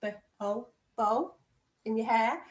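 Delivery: B, O, then bow said with the O vowel; other reading. Shown as a demonstration. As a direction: sound it as B, O, bow like in your hair. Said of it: "You said bow, couldn't you?".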